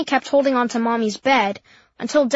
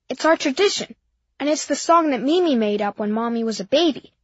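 usual speaking voice

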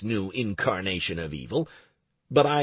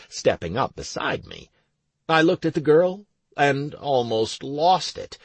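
usual speaking voice

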